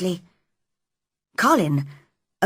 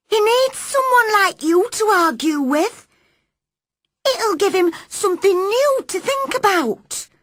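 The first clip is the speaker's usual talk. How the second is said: put-on high, louder young maid; eager maid; 14 semitones higher, 4 dB louder than usual. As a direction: urge her on warmly. Maid explaining to Mary.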